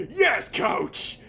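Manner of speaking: a loud, high yelled answer to the coach; eager shout. Acted. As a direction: shout it back like a recruit.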